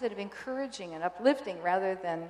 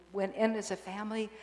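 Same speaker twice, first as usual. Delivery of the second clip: usual speaking voice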